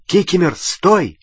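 a firm, understated shout; mild shout. A narrator's reading. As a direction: order the elf to stop. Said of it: "крикнул Гарри".

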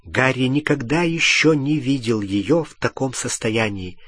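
ordinary delivery, nothing marked

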